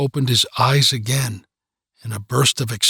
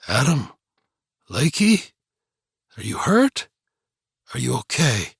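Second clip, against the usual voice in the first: panicked and hoarse. A narrator's reading. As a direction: rasp it out in a panic. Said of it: "In a panicked and hoarse voice".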